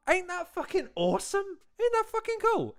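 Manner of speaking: very high-pitched, delighted gushing; gushing excitement. Real, not acted. Host thrilled that she's battling now, gushing excitedly.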